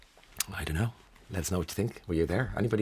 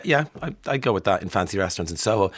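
normal talking voice

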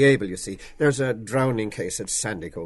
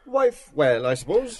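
changed voice; 6 semitones higher, 4 dB louder, slower than usual. A narrator's reading. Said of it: "McCoy's changed voice said".

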